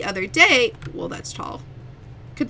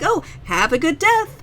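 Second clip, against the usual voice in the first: higher sing-song clerk farewell; chirpy shop-counter goodbye; customer service voice; 6 semitones higher, 3 dB louder than usual. Retold or imitated. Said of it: "it's just like".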